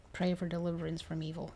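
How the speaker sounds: ordinary delivery, nothing marked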